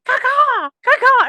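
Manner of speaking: loud, high mock crow calls; imitated bird noises. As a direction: squawk like a rowdy bird impersonator. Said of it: "bird noises going".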